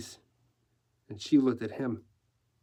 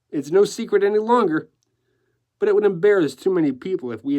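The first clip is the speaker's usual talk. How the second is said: louder, animated, building to high delight; gleeful decorator; 5 semitones higher, 6 dB louder than usual. Acted. Decorator enjoying his French pretense, gleeful. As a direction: revel in the open secret. Decorator's lines in narrated story.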